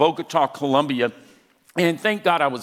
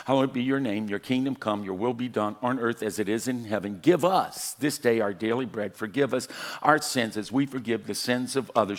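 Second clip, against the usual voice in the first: reciting prayer; 4 semitones lower, faster than usual. Retold or imitated. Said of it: "So we began to recite it".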